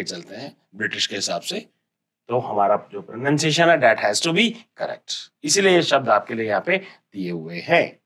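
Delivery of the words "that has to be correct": ordinary talk, nothing marked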